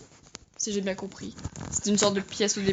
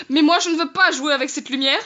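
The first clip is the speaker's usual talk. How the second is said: usual speaking voice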